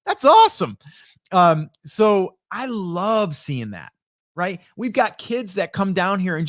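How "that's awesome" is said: very high, loud, thrilled; excited praise. Retold or imitated. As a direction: cheer them on like a delighted fan. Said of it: "And I'm like".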